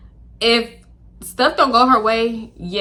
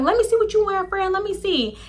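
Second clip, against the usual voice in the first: eager friend; 10 semitones higher than usual. Retold or imitated.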